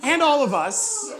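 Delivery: laughing it out mid-sentence. Real, not acted. Laughing aside to congregation.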